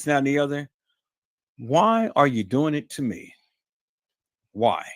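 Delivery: ordinary talk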